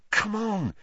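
usual speaking voice

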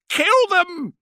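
very high, loud repeated shriek; panicked full scream. A narrator's reading. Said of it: "Sark screamed".

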